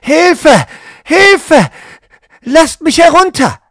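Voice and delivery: high, loud cries for help; calling for help. Acted. Trapped woman hanging upside down, loud cries for help. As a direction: scream for help from the trap. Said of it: "Sie ruft".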